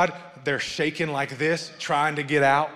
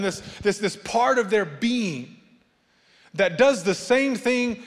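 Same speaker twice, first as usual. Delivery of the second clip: preaching at full force, high and loud; building preaching; 6 semitones higher, 4 dB louder than usual. Acted. Pastor preaching to the congregation, rising and loud. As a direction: drive the point home from the pulpit.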